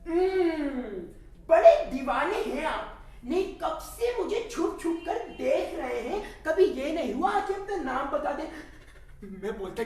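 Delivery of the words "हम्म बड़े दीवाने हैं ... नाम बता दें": higher, teasing female voice; young woman's voice. Acted. Sakina teases the man who stares at her, high coy voice. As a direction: play the girl coyly scolding her admirer. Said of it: "वो मेरे पास आई और बोली".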